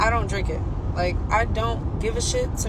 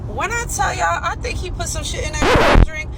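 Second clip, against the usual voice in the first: emphatic outburst; 5 semitones higher, 6 dB louder than usual. Real, not acted. Emphatic reveal to audience.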